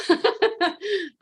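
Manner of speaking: high-pitched real laugh; amused laugh. Real, not acted. Teller laughing at her own retold reply, amused.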